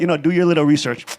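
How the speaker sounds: normal talking voice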